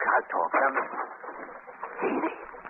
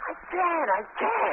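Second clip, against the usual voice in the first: high, panicked pleading; hired man terrified of naming the killer; terrified refusal; 14 semitones higher, much slower than usual. Acted. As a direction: beg off in fear. Cornered witness pleads in terror.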